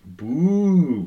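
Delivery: high, loud drawn-out boo; imitated booing. Re-enacting a crowd's boo for co-host, mock booing.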